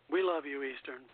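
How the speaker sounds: usual speaking voice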